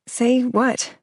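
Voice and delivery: weak voice. A narrator's reading. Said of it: "My voice is weak".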